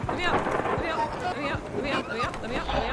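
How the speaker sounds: terrified gibbering. Real, not acted. Trapped by monster in game.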